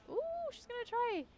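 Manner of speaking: very high-pitched playful cheer; high put-on spectator voice; high excited aside. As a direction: squeal it up high, rooting for her. Cheering on a game character.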